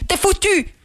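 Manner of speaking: high-pitched exclamation of "foutu"; emphatic exclamation. Real, not acted. Host drives home the warning to overloaded listeners, high and emphatic. Repeats the warning with more force.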